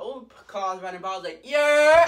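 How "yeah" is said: high, full-volume whooping yell; wild drawn-out cheer; hyped holler. Retold or imitated. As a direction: shout it at passing cars, stretched out. Hollering at passing cars.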